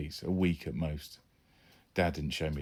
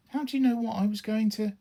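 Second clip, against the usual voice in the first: put-on boy's voice. Son cut off by dad.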